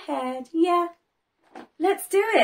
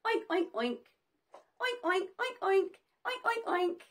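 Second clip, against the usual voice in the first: soft playful oinking; pig oink; 3 semitones higher, 9 dB quieter than usual. Retold or imitated. Teacher quietly showing children how a pig oinks. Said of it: "And they go".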